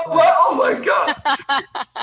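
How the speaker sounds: high, drawn-out cry through laughter; laughing exclamation. Real, not acted. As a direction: wail it while cracking up. Laughing at the misunderstanding.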